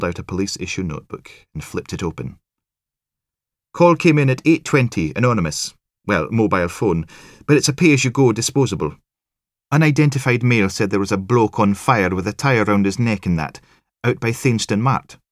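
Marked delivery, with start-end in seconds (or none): 3.7-15.2 high, loud brisk Highland report; keen Highland sergeant briefing; Highland accent. A narrator's reading. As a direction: read the notes out in a sing-song Highland lilt. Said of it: "sing-song Highlands and Islands accents".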